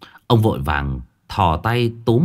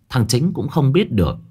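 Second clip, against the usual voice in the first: usual speaking voice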